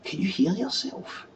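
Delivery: high, slow, incredulous question; incredulous retort. Acted. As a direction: stretch it out and lift the pitch at the end. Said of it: "But I'm just like that".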